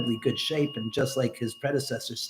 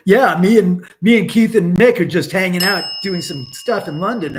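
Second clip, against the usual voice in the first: lightly voiced bragging fan, a bit louder; mock Jeff McCoy; 5 semitones higher, 10 dB louder than usual. Streamer mocks a chat viewer's brag, lightly voiced. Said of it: "As Jeff McCoy says".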